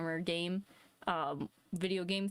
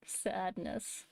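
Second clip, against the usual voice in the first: whispered sadness; 4 dB quieter than usual. Acted. Mock sad reaction to delay.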